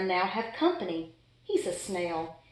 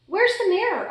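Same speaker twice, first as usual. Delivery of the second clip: excited; 10 semitones higher, 4 dB louder than usual. A narrator's reading. Excited question to friends.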